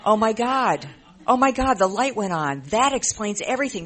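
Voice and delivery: the light just went on, exclaim it; stunned realization. Retold or imitated.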